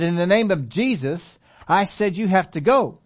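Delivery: commanding. Retold or imitated. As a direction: command the spirit to go, slowly and firmly. Commanding the demon a third time.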